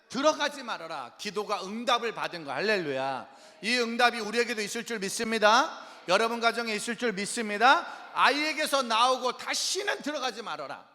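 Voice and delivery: loud, high commanding sermon cry; full-force preaching. Acted. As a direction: cast it out at the top of your voice.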